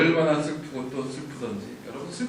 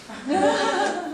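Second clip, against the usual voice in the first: high burst of laughter. Real, not acted. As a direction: break into a laugh. Laughs at spoiler joke.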